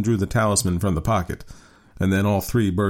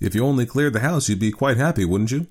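put-on higher-pitched, coaxing young man; young son; 6 semitones higher than usual. A narrator's reading. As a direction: cajole father in a lightly raised voice.

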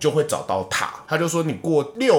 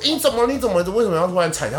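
alarmed calling; 8 semitones higher, 4 dB louder, faster than usual. Retold or imitated. Family rush to the screaming aunt.

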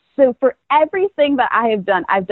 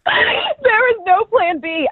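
high, slow admission through laughter; real laughing; 11 semitones higher, much slower than usual. Real, not acted. Guest admitting she had no backup, laughing. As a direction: echo it while cracking up.

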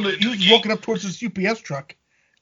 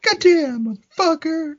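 higher, exasperated Southern wrestling manager; a light take on a ranting Cornette; Bruce Pritchard doing Jim Cornette; 9 semitones higher than usual. Retold or imitated. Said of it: "impersonation is of Bruce Pritchard doing Jim Cornette".

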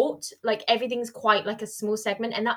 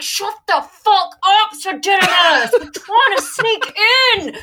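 loud high exasperated shout, slowed; angry shout; 11 semitones higher, 10 dB louder, slower than usual. Retold or imitated. Sarah snapping at Sir Didymus at the gates, loud shout. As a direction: yell him down in frustration. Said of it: "she's like".

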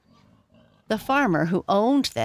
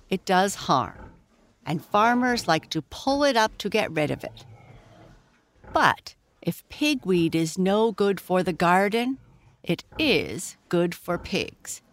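usual speaking voice

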